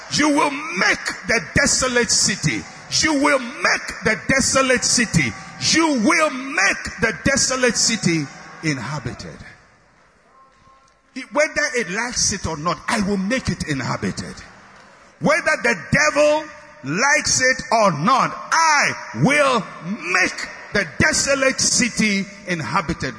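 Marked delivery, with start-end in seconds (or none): 0.1-9.4 higher, shouted preacher's cry; preaching at full force. Acted. Preacher urges the church to act with force, shouting. 15.2-23.2 higher, shouted preacher's cry; preaching at full force. Acted. Preacher urges the church to act with force, shouting.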